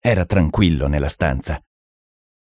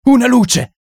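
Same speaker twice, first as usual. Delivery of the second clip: the panic climbs; higher shriek; 5 dB louder than usual. Acted. Someone in the dark room shrieks for a light, even higher. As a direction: shout it even higher and more desperate. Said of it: "strillò qualcuno".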